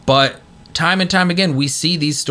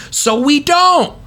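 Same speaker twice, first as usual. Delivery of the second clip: fervent; 11 semitones higher than usual. Real, not acted. Emphatic preaching.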